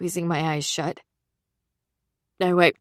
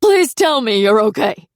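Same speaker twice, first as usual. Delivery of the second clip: choked, pleading; 4 semitones higher, 8 dB louder than usual. Acted. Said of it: "His voice choked and rose even higher".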